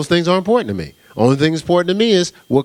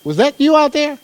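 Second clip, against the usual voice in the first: wife asking; 12 semitones higher than usual. Retold or imitated. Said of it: "my wife was like".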